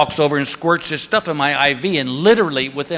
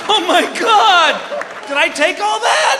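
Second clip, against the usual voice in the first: mock dismay; slower than usual. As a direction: ask in hammed-up horror. Imagined horror at scope length.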